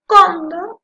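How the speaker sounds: "cóndor" with "cón" punched high and loud; stressed first syllable. Shown as a demonstration. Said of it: "la que suena con mayor fuerza".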